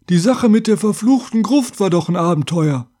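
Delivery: high, put-on voice of a simpleton; simple-minded big fellow. A narrator's reading. Big simple driver chimes in from the coachbox, simple-minded voice.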